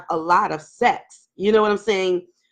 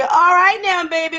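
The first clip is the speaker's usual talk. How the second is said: eager woman; 8 semitones higher, 4 dB louder than usual. Retold or imitated. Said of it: "they be".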